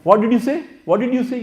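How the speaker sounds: high, feigned not-hearing question; mock deaf listener. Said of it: "Suddenly you will become deaf".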